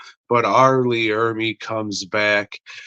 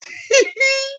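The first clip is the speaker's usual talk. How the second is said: cackle. Pretend. Host cackle after episode number.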